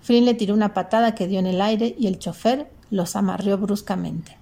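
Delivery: plain reading voice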